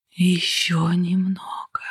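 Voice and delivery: breathy unvoiced whisper. A narrator's reading. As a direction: beckon her in a slow whisper. Mysterious voice calls her.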